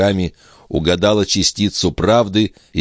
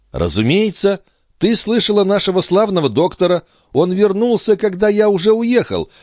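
forced cheerful reassurance. Acted. Brother feigning easy explanation.

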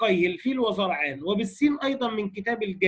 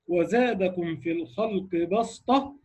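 slow Quranic recitation; 2 semitones lower, slower than usual. Shown as a demonstration. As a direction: recite the verse slowly in tajweed style. Quoting a Quran verse in recitation. (تلاوة مجودة بطيئة)

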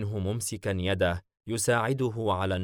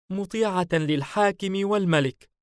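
high, louder submissive reply; female character voice; 11 semitones higher, 5 dB louder than usual. A narrator's reading. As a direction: protest obedience to her master. Su'luwa submits after being choked. (صوت سعلوة الخاضع)

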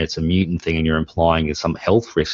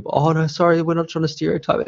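high, hurried backpedalling; mock the cast scrambling to apologise; flustered apology; 11 semitones higher than usual. Actors stop the play to apologise, flustered and pitched high. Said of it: "were really".

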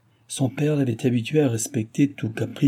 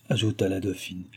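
plain reading voice

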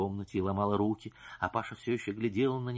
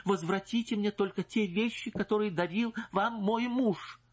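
insistent demand; 9 semitones higher than usual. Acted. Wife's high demand.

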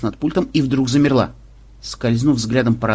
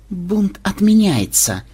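slow, higher, measured declaration; cold announcement; 8 semitones higher, much slower than usual. A narrator's reading. Rescuer behind the mutineer calls it off, coldly. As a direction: announce it coolly, stretching each word. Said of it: "холодно сообщил вдруг кто-то".

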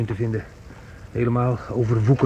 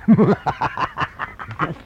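a higher, gloating laugh at his own story; mocking laugh; 5 semitones higher than usual. Son to mother after his cruel joke, gloating laugh. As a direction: laugh at her expense.

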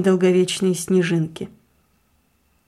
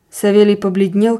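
plain reading voice

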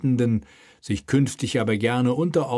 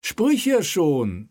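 slow, raised, pressing; urging king; 8 semitones higher, much slower than usual. Acted. King urges the hesitant mayor, pressing and slow. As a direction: push him to speak up. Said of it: "drängte Friedrich".

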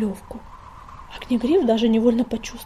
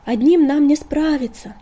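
loud shout; 5 semitones higher, 6 dB louder than usual. A narrator's reading. Shouting in the blizzard.

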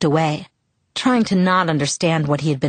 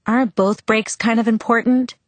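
higher-pitched doubtful question; suspicious; 5 semitones higher than usual. A narrator's reading. Learner questioning advice to forget a brake, suspicious. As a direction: challenge it with mild suspicion. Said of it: "I said suspiciously".

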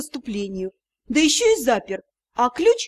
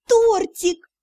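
high, eager squeal of delight; light up at the word; 8 semitones higher, 3 dB louder than usual. A narrator's reading. Lovestruck elf delighted by cake, eager and high.

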